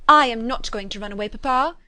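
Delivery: fretful protest to papa, a little loud. A narrator's reading. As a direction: whine back at papa, emphatic and put out. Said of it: "said Kitty fretfully".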